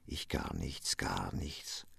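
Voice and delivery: a hollow, whispered nothing; hopeless whisper. A narrator's reading. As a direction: sink into an empty whisper. Lenz despairs of being nothing.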